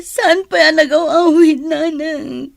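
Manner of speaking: crying and pleading for her missing husband; tearful pleading. Acted. Wife begging her mother-in-law through tears. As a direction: voice breaking with sobs as she asks.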